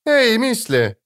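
high taunting shout; mocking call. A narrator's reading. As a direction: call out with a sneer. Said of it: "крикнул Кайлей, насмешливо усмехаясь".

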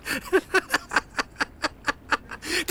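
high airy laugh of disbelief; breathy laugh. Acted. Burma laughs after losing the corpse, breathy. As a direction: laugh it off helplessly.

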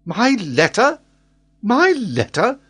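high, loud incredulous repeat; astonished exclamation. A narrator's reading. Glenarvan hearing of his supposed letter, astonished. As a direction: exclaim it twice, rising in disbelief. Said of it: "exclaimed Glenarvan".

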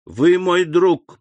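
slow and clipped through the teeth. A narrator's reading. Albert to Beauchamp, pressing his demand, through gritted teeth. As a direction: hold the anger back between the words. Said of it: "сквозь зубы".

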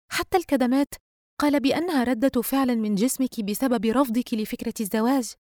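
lightly voiced insistent friend; explaining best friend. Friend reminds Yusra what her psychiatrist said, matter-of-fact.

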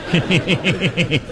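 sly evil heh-heh laugh; sinister cackle. Shown as a demonstration. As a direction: snicker like a villain. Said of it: "最恶毒的笑是".

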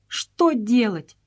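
slow, abashed question; deeply embarrassed. A narrator's reading. Stop asks the doctor, slow and deeply embarrassed. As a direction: ask helplessly, at a loss. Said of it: "с глубоким смущением проговорил Стоп".

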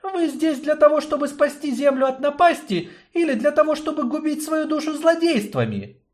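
high woman's voice, reproachful; young woman reproaching. Acted. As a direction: challenge them with a pointed question. Yarina questions the destroyer.

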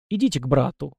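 plain reading voice

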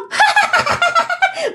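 high-pitched sustained cackle; shrieky, drawn-out mad laugh; eerie ghost laugh. Acted. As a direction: cackle like a vengeful spirit. Vengeful spirit laughs at friend.

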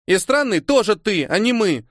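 put-on a bossy young girl firing back; girl exclaiming. A narrator's reading. Young girl answering Tolya, high excited exclaim. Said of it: "воскликнула девочка".

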